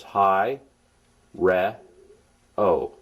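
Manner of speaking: "Hi, re, o." in three separate beats; syllables counted out. Shown as a demonstration. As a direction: count out "Hi, re, o." with a pause after each syllable. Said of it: "It has three syllables".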